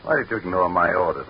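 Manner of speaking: ordinary delivery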